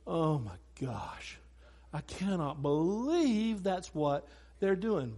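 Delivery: slow, low disbelief; imagined dismay. Retold or imitated. God looking down at us, slow dismayed disbelief. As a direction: say it slowly, as if stunned by their behavior.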